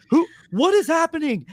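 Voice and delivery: high, bewildered cry at an unseen voice; panicked confusion. Retold or imitated. Man alone on a rooftop hears voices, high panicked confusion. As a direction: ask it high, as if losing your mind. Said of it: "on the rooftop in New York going".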